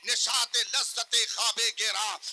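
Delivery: high, loud recitation of verse; impassioned preaching. Real, not acted. Preacher exhorts the gathering with verse, impassioned. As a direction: declaim the couplets at full force.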